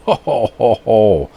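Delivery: high, loud delighted ho ho ho; excited chuckle. Real, not acted. Finding a signed comic in the package, loud delighted laugh. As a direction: burst out in gleeful ho-hos.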